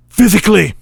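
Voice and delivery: high, loud theatrical punch; climactic shout. Acted. Magician challenging his rival to fight, shouting. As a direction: throw the word out as a villain's challenge.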